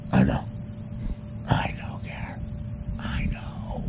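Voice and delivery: hushed whisper. Real, not acted. Comic whispering a secret message to Gary. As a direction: whisper it like a secret only he gets.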